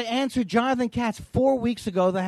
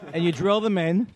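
ordinary delivery

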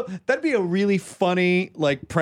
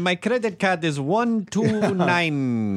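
put-on slow South African accent; playful South African accent; mock South African accent; 2 semitones higher, slower than usual. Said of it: "What is that accent?".

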